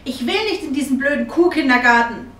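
angry refusal. A narrator's reading. Girl refusing the cow kindergarten, angry and loud. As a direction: throw a small tantrum on it.

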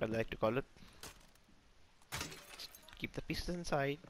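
normal talking voice

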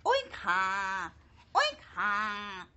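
low laughing oink; a pig chuckling awake. Acted. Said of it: "woke up with a laugh".

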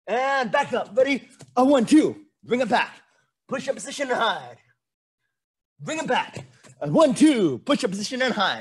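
high, energetic coach calling drill moves. Real, not acted. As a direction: punch out the counts loudly, then ease off on the move.

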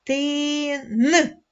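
ти stretched, then a separate hard н; isolated final н. Shown as a demonstration. Teacher finding the third sound, drawn-out ти and hard н. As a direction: hold ти, then sound н on its own. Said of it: "Третій звук".

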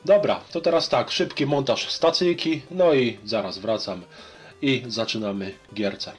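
ordinary talk, nothing marked

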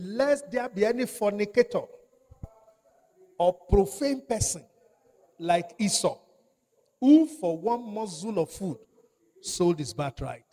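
loud, slow, ringing preacher's read; loud pulpit scripture reading; preaching scripture. Acted. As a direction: proclaim the verse to the church. Scripture read at full force.

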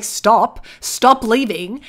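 loud, drawn-out imitation of a plea; mock pleading. Award winner calling to a leaving audience, mock pleading. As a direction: call after a departing crowd, hammed up. Voices ignored award speaker.

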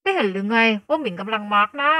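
loud, tight-mouthed sarcastic retort; barely moving the lips. Acted. Sister retorting to her brother, mouth held stiff. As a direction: snap back through a stiff face mask. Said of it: "หมิงพยายามขยับปากให้น้อยที่สุด".

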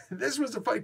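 chuckling through the words; laughing. Real, not acted. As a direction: laugh as you say it. Laughs on these lines.

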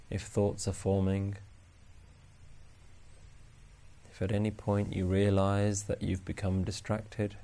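slow, soft, even meditation voice; guided meditation. Acted. Meditation teacher guiding listeners to notice each breath, calm and unhurried. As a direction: guide them gently through the breath with long pauses.

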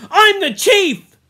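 high, loud, slow shout of victory; triumphant shout. Acted. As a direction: bellow it as the new chief. Jack claims leadership after attack.

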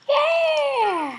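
long, high whoop; excited cheer. Real, not acted. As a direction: let out a drawn-out high cheer. Cheers at game moment.

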